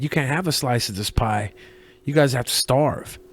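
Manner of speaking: ordinary talk, nothing marked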